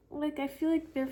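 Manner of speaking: usual speaking voice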